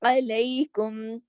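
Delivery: علیکم with 'ai' as in کئی; ya leen. Shown as a demonstration. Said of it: "کئی اور گئی سے ملتی ہے". (علیکم میں یا لین، کئی کی طرح)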